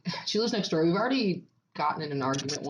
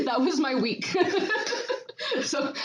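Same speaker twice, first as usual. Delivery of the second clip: higher, through real laughter; laughing; 9 semitones higher than usual. Real, not acted. Guest wrapping up her neighbor story, laughing. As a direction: laugh your way through the wrap-up.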